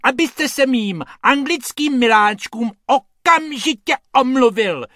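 high, loud outraged lady; strong shrill demand. Acted. The lady demands Hrubec apologise, loud and high. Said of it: "ječí ta dáma silným hlasem".

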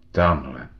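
low, husky reply; chraplavě. A narrator's reading. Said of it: "odpověděl Fíc chraplavě". (hlubokým chraplavým hlasem)

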